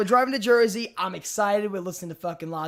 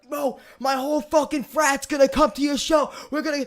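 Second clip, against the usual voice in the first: higher, hyped-up bro voice; imitated excited frat guy; 6 semitones higher than usual. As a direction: gush like a thrilled new fan.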